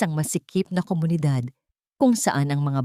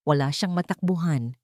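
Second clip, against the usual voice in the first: usual speaking voice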